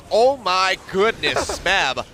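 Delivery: amazed, laughing shout of disbelief; excited laughing. Real, not acted. Caster reacts to a big play.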